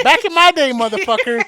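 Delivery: high, loud mock indignation; indignant old-timer retort. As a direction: snap back like an outraged old-timer. Retold old-timer retort to clerk.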